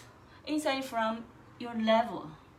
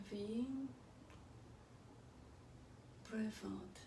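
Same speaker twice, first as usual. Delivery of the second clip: soft spa whisper; 16 dB quieter than usual. Acted. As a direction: whisper the breathing cues gently. Guided breathing, very quiet.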